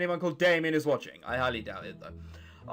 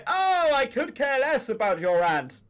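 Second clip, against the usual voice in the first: slow, louder and higher know-it-all jab; mock smug commenter; 5 semitones higher, 5 dB louder than usual. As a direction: ham up an internet commenter. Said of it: "who's gonna go".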